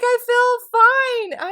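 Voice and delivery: high, slow "I feel fine"; drawn out, high and breezy; mock casual denial. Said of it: "I'm like".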